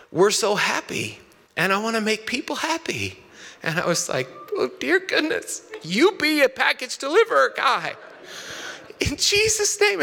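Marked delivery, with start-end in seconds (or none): 0.1-3.2 lightly voiced little boy, higher pitch; a hint of an earnest child's voice; young son. Six-year-old son telling dad his dream job, lightly imitated child voice. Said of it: "my son said". 4.6-10.0 high-pitched, melting reaction; touched, gushing dad. Retold or imitated. Dad blessing his son's sweet career plan, high gushing voice.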